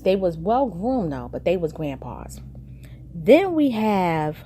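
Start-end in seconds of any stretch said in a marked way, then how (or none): 3.2-4.4 slow, drawn-out reveal; dramatic announcer. Acted. Host teasing the next scene, slow and drawn out. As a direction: stretch it out like a show host.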